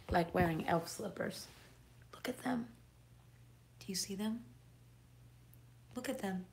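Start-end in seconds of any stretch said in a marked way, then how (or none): 2.1-6.5 whisper. Real, not acted. Showing off elf slippers on stream.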